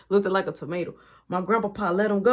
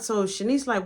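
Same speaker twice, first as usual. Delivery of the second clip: ordinary talk, nothing marked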